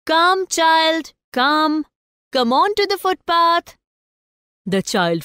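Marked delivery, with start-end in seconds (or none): 0.0-3.8 high, louder motherly call; cautionary mother. A narrator's reading. Mother calls her child on to the footpath, cautionary high call. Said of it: "His mother gave a cautionary call".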